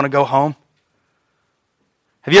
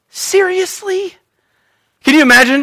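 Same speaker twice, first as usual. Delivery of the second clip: incredulous; 11 semitones higher than usual. Real, not acted. Incredulous aside.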